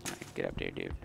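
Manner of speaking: ordinary delivery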